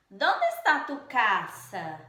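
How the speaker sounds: emphatic slow question demo; question intonation. Shown as a demonstration. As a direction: give "Dónde" and "casa" a strong first syllable, slowly. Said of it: "nós devemos entonar da seguinte maneira".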